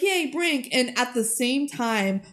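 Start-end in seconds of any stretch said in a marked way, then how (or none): 0.0-0.7 cheering crowd. Retold or imitated. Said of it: "They're like".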